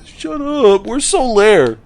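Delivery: high mock-irritated snap; mock annoyed. Player telling off the parent voice, high and annoyed. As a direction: brush off the nagging parent, high. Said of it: "And I was like".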